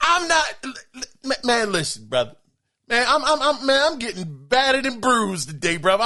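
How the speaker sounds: tongue-tied, sputtering in mock exasperation; flustered stammering. Real, not acted. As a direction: stumble and restart on first words. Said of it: "You got me stuttering".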